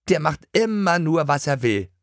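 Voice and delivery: put-on complaining girl. A narrator's reading. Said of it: "beschwerte sich Lotte".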